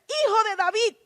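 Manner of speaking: imitando un clamor agudo y fuerte; exclámalo alto, como llamando a Jesús; clamor actuado. Acted. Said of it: "Bartimeo le gritó". (clamor fuerte en tono alto)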